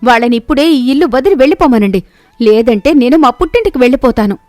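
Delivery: wife forcing husband to choose, angrily; ultimatum. Acted. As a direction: lay down the law, no arguing.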